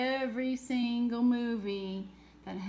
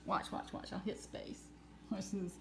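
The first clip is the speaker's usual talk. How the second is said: hushed; 3 semitones lower, 9 dB quieter than usual. Real, not acted. Showing the screen closely.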